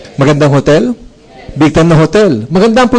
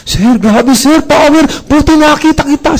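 higher, gushing with excitement; eager excited inviter; 8 semitones higher than usual. Retold or imitated. Recruiter greeting his old boss, high and overeager. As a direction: gush like a pushy recruiter who just spotted you. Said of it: "gigil na gigil siya sa pag-imbita".

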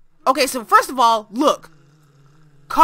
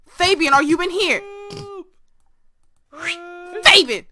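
raised voice, calling for someone lost; 7 semitones higher than usual. Real, not acted. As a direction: call out into the distance to find them. Calls out to missing character.

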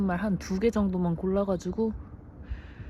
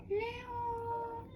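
calling out; 11 dB quieter, much slower than usual. Real, not acted. Calling dog, long drawn.

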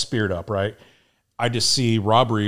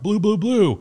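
high-pitched repeated call; retold signal call; 9 semitones higher than usual. Throwing chem lights over the wall, high retold call. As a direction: sing out high to warn the other side.

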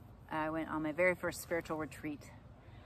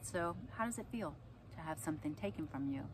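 softer, quiet question; gentle lesson; 8 dB quieter than usual. Retold or imitated. Mom asking son how the loss felt, quiet and gentle. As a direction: ask it gently, letting it sink in.

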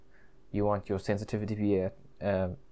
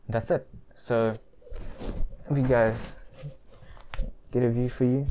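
muffled behind cloth mask; speaking with mouth covered; talking through a face mask; 3 dB louder than usual. Real, not acted. Seen 0: Fanged cloth mask covers his mouth and nose; lights not visibly on.

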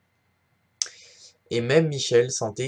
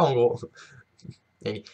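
usual speaking voice

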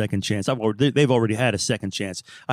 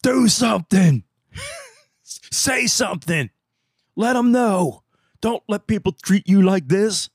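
neighborhood mentality shout; 7 semitones higher, slower than usual. Retold or imitated. The neighborhood voice pounding on his head, shouting. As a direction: yell at yourself to stand up. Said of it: "our neighborhood mentality, it's banging on my head".

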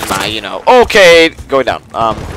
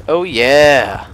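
usual speaking voice

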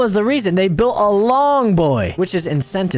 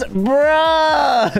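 mock enemy bruh; 7 semitones higher than usual. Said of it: "He goes".

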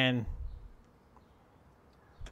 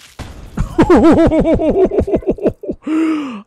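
loud laugh; 9 dB louder than usual. Real, not acted. Laughs at game moment.

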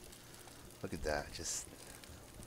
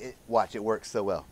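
ordinary delivery

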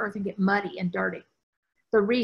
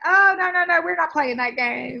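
loud refusal; 6 semitones higher, 7 dB louder than usual. Retold or imitated. Refusing client's excuse on beach.

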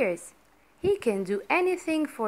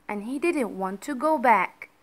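usual speaking voice

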